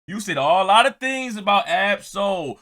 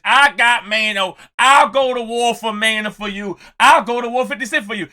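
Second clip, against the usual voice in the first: normal talking voice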